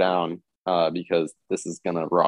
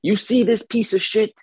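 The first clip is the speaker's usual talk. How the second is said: imitated clerk; 9 semitones higher than usual. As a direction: do the clerk griping in a high put-on voice. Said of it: "the clerk was talking to me".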